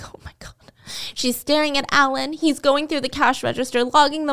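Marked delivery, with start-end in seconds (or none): 0.0-0.5 voiceless horrified whisper under the breath; panicked whisper. Retold or imitated. Waitress spotting her hidden bottle near the boss, whispering in panic. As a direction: whisper it as if the boss might hear.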